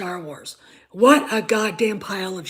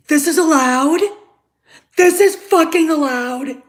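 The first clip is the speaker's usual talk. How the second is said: loud, high incredulous demand; can't believe it, furious; outraged disbelief; 5 semitones higher, 6 dB louder than usual. Real, not acted.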